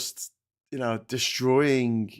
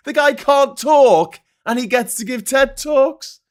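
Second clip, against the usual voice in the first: loud disbelieving rant; incredulous shout; 10 semitones higher, 8 dB louder than usual. Real, not acted.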